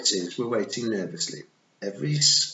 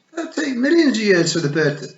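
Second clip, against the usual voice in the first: higher, louder rushed protest; outburst; 14 semitones higher, 9 dB louder than usual. A narrator's reading. Fionn protesting before Rob cuts in, loud. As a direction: keep blurting until cut off.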